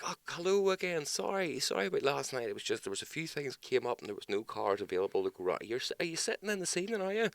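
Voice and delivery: mock polite cop. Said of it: "It's like".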